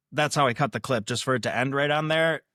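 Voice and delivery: normal talking voice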